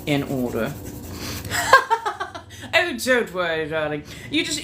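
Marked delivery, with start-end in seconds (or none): 0.8-2.7 high, airy giggle; a breathy, high laugh at herself. Real, not acted. Giggling at own feral mood.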